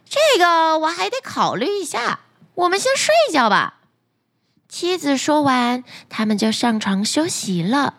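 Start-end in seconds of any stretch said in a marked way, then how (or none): 0.1-3.8 higher, quick scheming wife; greedy wife. Acted. Greedy wife putting off her husband, high and quick. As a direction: brush him off, high and brisk.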